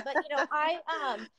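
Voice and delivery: ordinary talk, nothing marked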